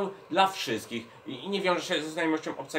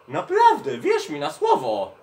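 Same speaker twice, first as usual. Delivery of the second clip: much higher and louder, amused insistence; laughing protest; 7 dB louder than usual. Acted. Host insists to doubting Uszak he made nothing up, high protest. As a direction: squeal in amused self-defence. Protesting he did not invent it.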